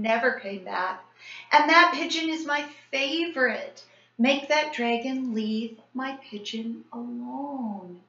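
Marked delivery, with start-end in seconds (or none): 1.5-8.0 pleading. A narrator's reading. Tony pleading about pigeon.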